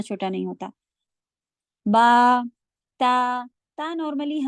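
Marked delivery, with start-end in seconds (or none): 1.9-2.4 با said full and strong with a loud open vowel. Shown as a demonstration. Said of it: "با اتنا بڑا ہوتا ہے یہ". (با بھرپور اور زور سے)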